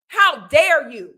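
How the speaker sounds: slow, loud, outraged; indignant. Real, not acted. Vlogger scolding the movie's fans, slow loud outrage. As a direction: spit it out slowly and loud.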